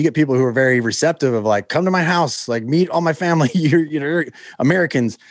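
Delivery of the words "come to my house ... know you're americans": high, gushing invitation; eager welcome. Retold or imitated. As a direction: mimic an overeager, thrilled host. Welcoming locals invite the Americans home.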